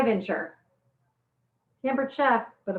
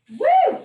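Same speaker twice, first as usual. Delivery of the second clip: sharp whoop, much higher and louder; high woo; 8 dB louder than usual. Real, not acted. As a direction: let out a high whoop at the sting. Eyes burning from onions.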